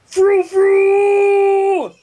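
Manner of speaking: high, loud war whoop; booming Big Dash cry. Acted. Twilight remembering Big Dash's odd word: loud high cry. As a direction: belt it out as a silly cry. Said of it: "weirdly deep, booming voice of Big Dash".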